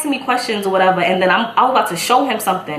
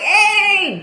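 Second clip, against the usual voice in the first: high, stretched-out hey; hyped greeting; 12 semitones higher than usual. Retold or imitated. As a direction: play up an excited hello. Acts out her hyped greeting back.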